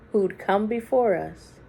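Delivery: ordinary delivery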